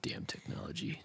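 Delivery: slow whisper; mock muttered grumble. Caught texting late, mock-sinister whisper. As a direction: hiss it slowly under the breath.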